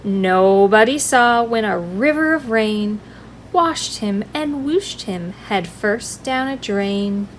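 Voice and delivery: usual speaking voice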